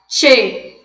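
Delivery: CHE said "tchê", T before the hiss; gaúcho tchê. Shown as a demonstration. Teacher telling students to sound like gaúchos, "tchê". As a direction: land firmly on CHE as "tchê". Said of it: "É o che de gaúcho mesmo".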